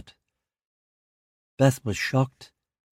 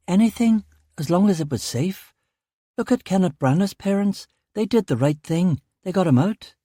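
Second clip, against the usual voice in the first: higher, anxious wife's voice; Beth's voice; 7 semitones higher than usual. Acted. Wife begging husband to leave Ireland, anxious. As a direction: plead for safety in a raised voice.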